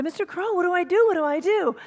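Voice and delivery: high, pleading cry for help; panicked plea. Acted. Little eagle stuck on the cliff begging the crow, high and frantic.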